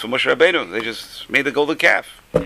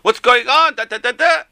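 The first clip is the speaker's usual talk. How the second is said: higher, louder imitation of an impatient crowd yelling; mock crowd shouting; 13 semitones higher, 5 dB louder than usual. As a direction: shout it like the pushy post office line. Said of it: "people started screaming and shouting".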